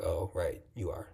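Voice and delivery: a small, sheepish "oh, right"; meek concession. Pretend. Conceding to inner Huma, soft and sheepish. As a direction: back down softly like you've been caught.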